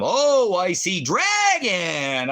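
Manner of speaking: high, slow taunting sing-song on "I see dragon"; mocking sing-song. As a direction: tease it high and slow like calling a child.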